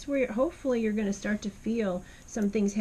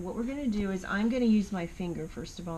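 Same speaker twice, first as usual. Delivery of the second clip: usual speaking voice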